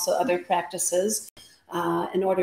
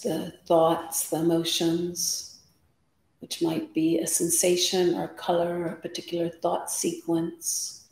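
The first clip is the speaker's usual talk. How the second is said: guided meditation. Acted. Yoga teacher settling the class before practice, guided meditation. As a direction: guide them into stillness, unhurried, phrase by phrase.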